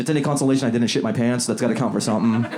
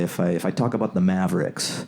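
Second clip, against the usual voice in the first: usual speaking voice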